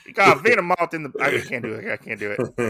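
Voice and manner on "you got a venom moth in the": loud, fast attempt at Australian; Australian accent. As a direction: have a rushed go at Australian, pitched up. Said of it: "go with your Australian accent".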